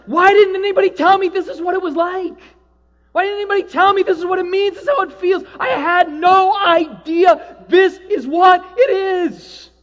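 shouted astonished revelation; ecstatic cry. Retold or imitated. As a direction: cry out the discovery. Retold revelation, preached loudly.

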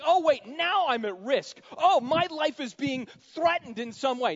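loud, high, swaggering Peter voice; Peter's boast. Acted. Preacher voicing Peter boasting he'll never deny Jesus, loud and high. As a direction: belt out Peter's brash promises.

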